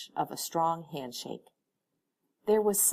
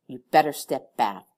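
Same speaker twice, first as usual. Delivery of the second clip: louder, tense protective warning; 3 semitones lower, 9 dB louder than usual. A narrator's reading. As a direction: back him off firmly. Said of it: "hear the tension in her voice".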